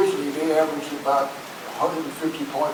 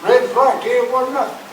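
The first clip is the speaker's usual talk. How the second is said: louder, higher mock of his stubborn dad; father voiced as a defiant fan; imitating his father; 10 semitones higher, 7 dB louder than usual. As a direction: belt it out like a grumpy fan father. Father quoted in story.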